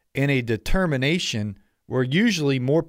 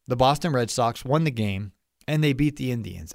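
ordinary talk

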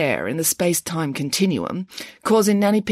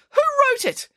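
much higher demanding squeal; indignant pig. A narrator's reading. Nanny Piggins demands the author, much higher. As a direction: demand it in a shrill outrage.

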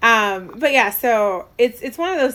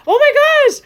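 very high, loud fangirl exclamation; amazed reaction; 10 dB louder than usual. Retold or imitated. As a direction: squeal it loudly in amazement. Acting out her amazed reaction.

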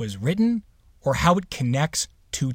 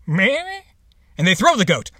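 mock dramatic throw; 8 semitones higher, 5 dB louder, slower than usual. Shown as a demonstration. Acting out a dad's sound effects.

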